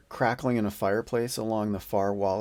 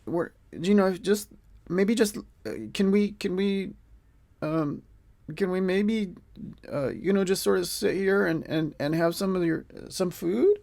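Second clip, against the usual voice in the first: higher, halting acted request; tentative guest; 9 semitones higher than usual. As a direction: ask timidly, with little pauses and restarts. Adventurers hesitantly ask to sit and eat.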